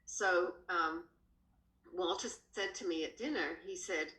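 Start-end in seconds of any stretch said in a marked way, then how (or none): none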